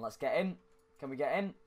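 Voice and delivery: ordinary talk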